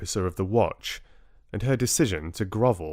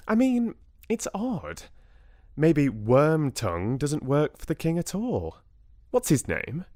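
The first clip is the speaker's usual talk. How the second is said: higher-pitched, lightly voiced doubting friend; friend Lottie, sceptical; 7 semitones higher than usual. Lottie doubts the caller to her friend, sceptical. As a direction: float a higher, questioning suspicion to a friend.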